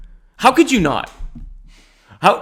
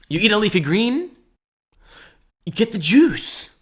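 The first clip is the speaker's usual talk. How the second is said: normal talking voice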